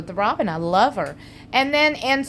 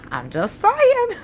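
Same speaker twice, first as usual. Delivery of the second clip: sing-song tease; 11 semitones higher, slower than usual. Real, not acted.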